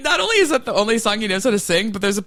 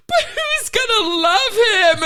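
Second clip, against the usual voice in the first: high, drawn-out tearful wail; mock crying; 3 dB louder, slower than usual. Imitating her crying over the whale, high mock wail. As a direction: wail it slowly, hammed-up heartbreak. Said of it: "she's crying".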